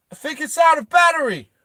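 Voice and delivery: high, loud shouted quip; loud comic call. Acted. [name] looks back at Hammy, high loud quip. [name]'s acted comment to Hammy.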